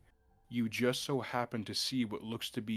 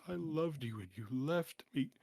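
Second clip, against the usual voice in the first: put-on higher, tearful girl's voice; inconsolable weeping; 4 semitones higher than usual. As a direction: weep it out, heartbroken. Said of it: "broken, sobbing voice".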